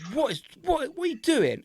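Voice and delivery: put-on baffled at the kid dropping the booklet; incredulous. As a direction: ask it high and baffled. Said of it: "it's like".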